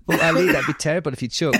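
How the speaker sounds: ordinary talk, nothing marked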